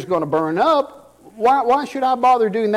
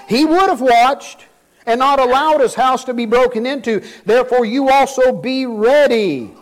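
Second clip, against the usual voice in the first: raised, emphatic scripture reading; 3 semitones higher, 3 dB louder than usual. Acted. Preacher reading a warning verse to his congregation, emphatic scripture reading.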